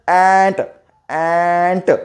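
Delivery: long ॲ, then a released ट in ॲन्ट; stretched phonics demo. Shown as a demonstration. Teacher modelling the sound of A, ॲन्ट stretched out. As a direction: draw out the open vowel in ॲन्ट. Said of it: "काय उच्चार करायचा?".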